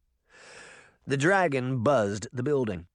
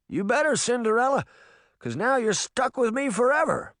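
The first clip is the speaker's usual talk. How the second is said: put-on playful young man; 11 semitones higher, 3 dB louder than usual. A narrator's reading. Young Tom teasing.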